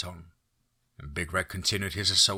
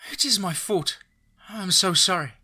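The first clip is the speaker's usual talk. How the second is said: high, tearful plea, a touch louder; a crying woman begging forgiveness; crying apology; 11 semitones higher, 4 dB louder than usual. A narrator's reading. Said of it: "saw Izzy crying".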